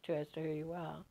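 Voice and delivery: normal talking voice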